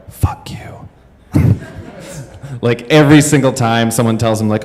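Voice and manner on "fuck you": unvoiced-sounding whisper of spite; imitated muttered curse. Talker cursing those who shush him, whispered. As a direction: whisper it under your breath.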